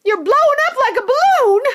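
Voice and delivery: very high loud screech; screeching mother. Acted. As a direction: shriek at the swelling girl. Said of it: "screeched Missus Beauregard".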